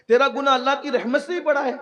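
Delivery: high, loud, quick challenge; incredulous question. Retold or imitated. Master challenges youth over his sin and God's mercy, incredulous question.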